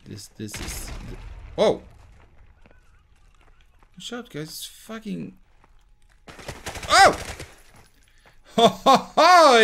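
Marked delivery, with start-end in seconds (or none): none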